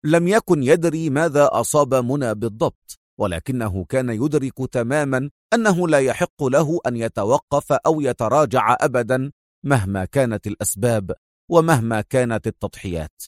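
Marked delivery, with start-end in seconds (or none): none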